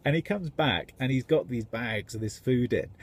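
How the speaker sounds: ordinary talk, nothing marked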